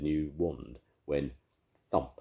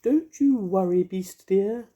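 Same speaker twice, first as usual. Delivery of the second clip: very high, louder fairy voice; comforting fairy; 9 dB louder, slower than usual. A narrator's reading. Mavis comforting the ice lolly in a high fairy voice. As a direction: soothe him in a tiny high fairy voice.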